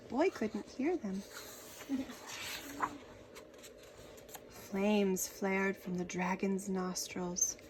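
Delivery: plain reading voice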